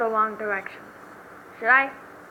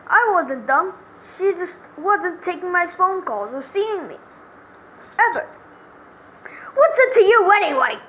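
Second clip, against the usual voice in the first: high, loud, offended protest; indignant defendant; 6 semitones higher, 5 dB louder than usual. Acted. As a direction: protest loudly as the jilted boyfriend.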